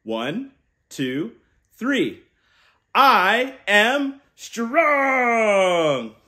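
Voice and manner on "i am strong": very slow, loud, higher, stretched to the end; rousing shout. Shown as a demonstration. Uncle leading kids in a big shout. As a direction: belt it out so kids shout along. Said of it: "On the count of three".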